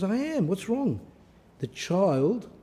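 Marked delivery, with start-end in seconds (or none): 0.0-1.0 impatient mother. Retold or imitated. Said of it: "And she says".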